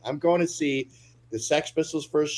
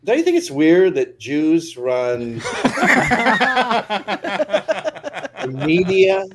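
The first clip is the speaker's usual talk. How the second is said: laughing; 6 semitones higher, 5 dB louder than usual. Acted. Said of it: "I was like".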